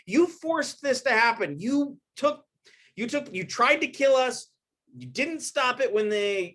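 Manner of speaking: a hint of a scornful warrior's taunt. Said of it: "Arjuna taunts him and says".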